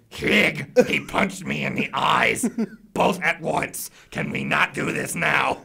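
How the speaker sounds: slow, put-on goblin whine; aggrieved little creature voice; complaining goblin. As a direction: grumble slowly as a hurt goblin. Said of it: "One of the goblins looks at him and goes".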